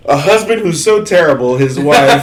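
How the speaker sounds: can't keep a straight face telling it; laughing. Real, not acted. Laughing through own story summary.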